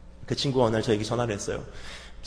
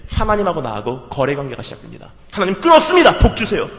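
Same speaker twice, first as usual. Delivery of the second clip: usual speaking voice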